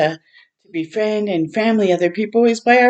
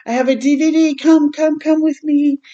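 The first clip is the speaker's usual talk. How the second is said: retold coaxing call; 9 semitones higher, 4 dB louder than usual.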